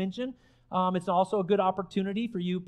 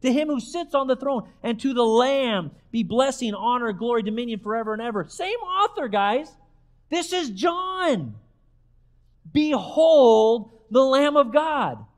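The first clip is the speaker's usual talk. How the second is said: raised, ringing proclamation of scripture; preacher building to a loud declaration; emphatic preaching; 2 semitones higher, 4 dB louder than usual. Acted. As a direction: proclaim it to the congregation, holding the key words. Preaches scripture at full force.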